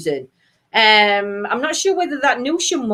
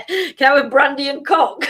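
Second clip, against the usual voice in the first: giggling question; laughing; 6 semitones higher than usual. Real, not acted. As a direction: laugh through the words. Reading joke aloud, laughing.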